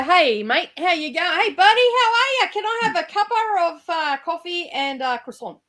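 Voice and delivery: chirpy broad Australian greeting, high and quick at first. Pretend. Aussie ordering coffee in a Paris café, broad Australian accent. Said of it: "I'll walk in and go".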